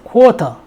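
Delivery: "quarter" British-style, R dropped; British "quarter" with a hard T; British pronunciation. Shown as a demonstration. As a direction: say "quarter" with no R at the end. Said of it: "영국에서는".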